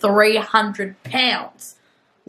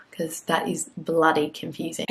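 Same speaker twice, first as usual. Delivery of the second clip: whispered aside; 3 semitones lower, 5 dB quieter than usual. Real, not acted. Vlogger whispering a joke aside to viewers, whispered. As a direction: whisper it as a side comment. Muttered aside about temperature units.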